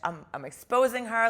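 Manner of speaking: fired up, ready to expose her; went crazy. Retold or imitated. Retelling her furious plan to expose the woman, loud. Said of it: "went crazy".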